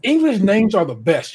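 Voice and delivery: ordinary delivery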